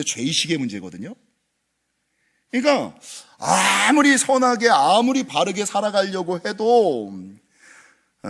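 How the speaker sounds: ordinary talk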